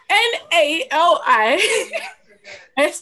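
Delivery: slow spelling. Shown as a demonstration. Spelling the name out slowly.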